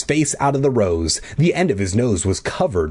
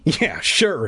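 ordinary delivery

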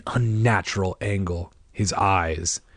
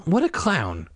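usual speaking voice

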